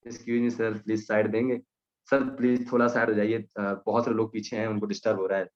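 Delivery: lovingly polite. Retold or imitated. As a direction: coax the well-dressed man aside with mock respect. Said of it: "इतने प्यार से बोलेंगे".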